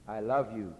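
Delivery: low, simple, tender delivery; sincere I love you. Shown as a demonstration. Preacher contrasting tones with a sincere I love you, tender. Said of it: "Or I can say".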